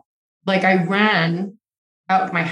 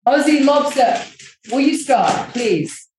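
high, raised telling-off of a pet; scolding the dog; 5 semitones higher, slower than usual. Real, not acted. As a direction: shout at the dog to stop. Scolding noisy dog mid-podcast.

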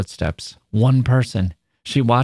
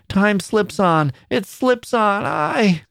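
much higher, slow wistful grandmother; musing old woman; 10 semitones higher, much slower than usual. A narrator's reading. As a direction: sigh over passing time. Grandmother voice held.

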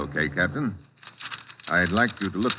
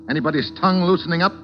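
louder, higher, pushing; pressing hard; 7 semitones higher, 4 dB louder than usual. Acted. Detective to the suspects, loud and pressing. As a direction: lean on them as the clock runs down.

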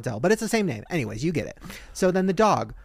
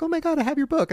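high, gushing fan squeal; excited fan; 11 semitones higher, faster than usual. Retold or imitated. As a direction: gush like a starstruck fan. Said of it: "they're like".

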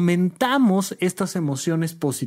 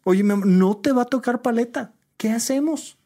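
put-on higher, tender asking a child; gentle parent; 5 semitones higher than usual.

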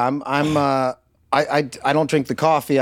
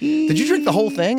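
high-pitched mock disbelief; retold incredulous question; 12 semitones higher than usual. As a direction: quote a friend's startled question, voice jumping up high. Repeats the friend's startled question.

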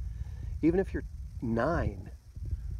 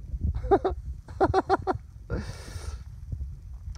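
long, high fit of giggles; real, helpless chuckling; laughing. Real, not acted. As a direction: burst out laughing at your own bit, letting it run on. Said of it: "laughing, okay?".

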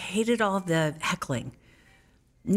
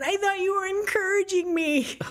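high, innocent squeak; lightly voiced naive girl; naive young self; 14 semitones higher than usual. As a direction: play it wide-eyed and clueless. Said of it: "And I said".

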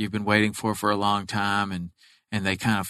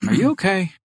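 worried buddy; 10 semitones higher, 4 dB louder than usual. Retold or imitated. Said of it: "his buddy's like".

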